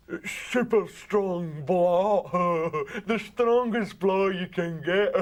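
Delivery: slow, giggling stoned boast; stoned laughing. Retold or imitated. Brian boasts about the skunk, slow and giggling. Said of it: "And he says".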